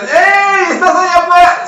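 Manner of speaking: high, loud frog holler; calling out. Acted. Two trapped frogs hail the third, calling out. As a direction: shout to catch someone's attention. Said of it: "Y le gritan".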